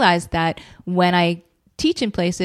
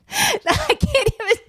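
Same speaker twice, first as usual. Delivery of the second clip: laughing. Real, not acted. Laughing at own impression.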